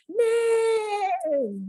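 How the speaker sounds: high whinnying neigh; whinny like a horse. Pretend. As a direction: neigh for the children. Horse sound imitation.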